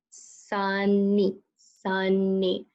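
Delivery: "Sunny" in two drawn-out syllables, sun-nee; split into syllables. Shown as a demonstration. Teacher showing a two-syllable word, "Sunny" split as sun-nee. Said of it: "in a two-syllable word".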